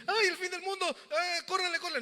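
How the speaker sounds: high, alarmed "córrele, córrele" as if fleeing; hammed-up panic at the end of the world; mock panicked cry. Mocking believers who panic about the end of the world, alarmed. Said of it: "No decir".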